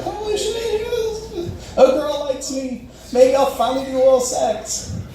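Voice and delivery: high, squeaky mock excitement; giddy inner glee. He realizes a girl likes him, high-pitched giddy glee. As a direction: celebrate privately in a high little voice.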